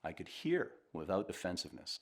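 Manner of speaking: normal talking voice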